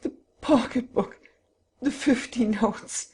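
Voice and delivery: high, slow despairing voice. A narrator's reading. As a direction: let it fall apart in despair. Robbed husband despairs.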